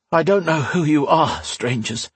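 a touch out of breath after laughing; panting. A narrator's reading. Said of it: "he panted".

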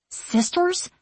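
high, rising, fearful question; startled and uneasy, voice jumping up; suddenly afraid. A narrator's reading. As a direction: ask it in sudden alarm, pitch lifting. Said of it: "suddenly afraid".

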